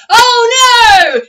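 high, loud dismayed cry; crowd alarm. Retold or imitated. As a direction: gasp as someone falls.

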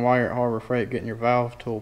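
ordinary delivery

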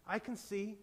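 hushed amazement; quiet wonder. A narrator's reading. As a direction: breathe it out as a gentle realization. Woman discovers she can see.

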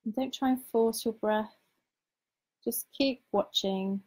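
guided meditation. Acted. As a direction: lead them gently into calm, unhurried.

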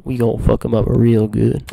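loud, slow drawn-out boast; hyped boast. Real, not acted. Gamer hyping his army for the assault, loud and slow. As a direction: savor the threat.

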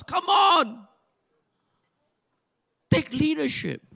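ordinary talk, nothing marked

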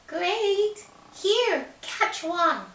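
high loud cheeky pig; little pig voice. Acted. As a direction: squeak it cheekily. Pig answers.

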